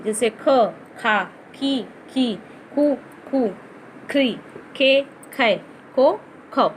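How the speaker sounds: ख खा खी खु खे खै खो खौ, spoken one by one; reciting syllables. Shown as a demonstration. Teacher drilling ख syllables for pupils, clear recitation. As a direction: sound out each matra on ख. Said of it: "उच्चारण है वो बदल गए हैं".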